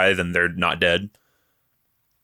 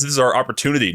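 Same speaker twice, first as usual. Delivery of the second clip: ordinary delivery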